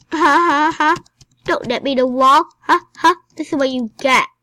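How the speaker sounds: loud taunt with a put-on "ha ha ha"; mock gloating. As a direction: crow over a beaten foe in play. Taunts the game grandma.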